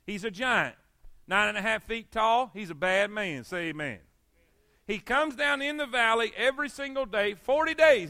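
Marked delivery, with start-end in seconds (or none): none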